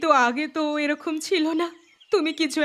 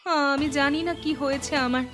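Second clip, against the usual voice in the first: ordinary talk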